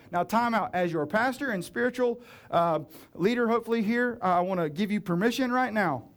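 ordinary delivery

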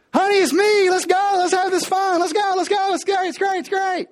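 a hammed-up husband begging at the door; eager husband. Solomon knocking at 3 AM.

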